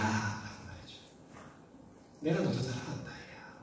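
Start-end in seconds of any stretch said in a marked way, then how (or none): 2.2-3.6 tender comfort. Acted. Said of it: "위로해주고 만져주는".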